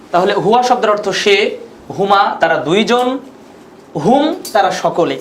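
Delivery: normal talking voice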